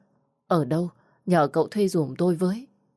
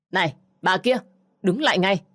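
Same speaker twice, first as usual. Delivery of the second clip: mild call to stop, somewhat louder; shouting out; 3 semitones higher, 4 dB louder than usual. Acted. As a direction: call after her across the road. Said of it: "Bỗng có một tiếng la lớn".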